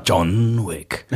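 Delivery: booming movie trailer announcer. Shown as a demonstration. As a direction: deliver the title like a trailer. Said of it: "mit der Trailerstimme".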